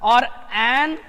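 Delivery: ordinary delivery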